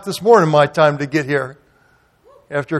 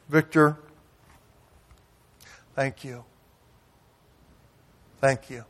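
quiet thanks; 3 semitones lower, 11 dB quieter than usual. Retold or imitated. Tearful friend thanks him.

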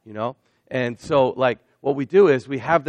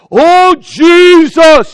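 drawn-out, shouted mock-holy groan; overblown prayer; 19 dB louder, much slower than usual. Preacher mocking people who pray too theatrically, hammed-up slow wail. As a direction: ham up a showy prayer voice. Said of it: "you don't need to go".

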